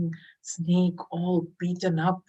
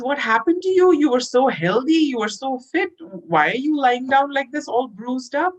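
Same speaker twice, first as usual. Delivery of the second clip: higher, louder cry of dismay; shocked concern; 8 semitones higher, 5 dB louder than usual. Acted. Sadhu shocked at his bruised snake friend, higher dismayed cry. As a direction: gasp at the hurt friend.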